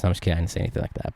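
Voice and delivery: a whispered aside to the viewers. Real, not acted. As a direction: drop to a whisper to admit the joke. Aside correcting his own joke.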